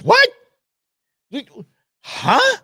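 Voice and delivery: high, baffled squeak of surprise; stunned disbelief. Retold or imitated. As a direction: replay his own shock. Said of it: "I was like".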